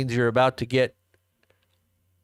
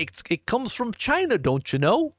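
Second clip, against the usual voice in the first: high-pitched comic Canadian lilt; mock Canadian accent; 10 semitones higher than usual. Host imitating his Canadian friends, mock Canadian accent. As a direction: imitate a Canadian, leaping high then rising at the end. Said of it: "the Canadians are gonna be like".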